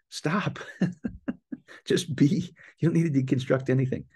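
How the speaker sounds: high, insistent call to just stop and be; emphatic stop. Real, not acted. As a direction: cut them off with a bright, urgent plea. Telling listeners to stop deconstructing.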